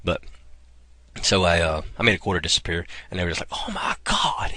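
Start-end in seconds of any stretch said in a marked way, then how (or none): 3.5-4.5 breathless, slow whispered gasp of amazement; amazed gasp. Retold or imitated. Kids watching a quarter vanish, gasping in a slow whisper. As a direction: let the awe steal your voice.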